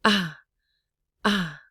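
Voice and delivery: "ah" short with breath falling out; breathy low tone. Shown as a demonstration. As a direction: let air escape through a brief "ah". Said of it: "this is the breathy low tone".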